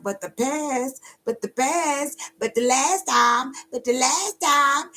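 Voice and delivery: high, drawn-out imitation of complaining; mock whining. Voicing someone stuck on the past, mock whining. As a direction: drag it out in a high mocking whine.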